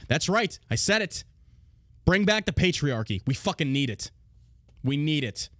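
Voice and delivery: normal talking voice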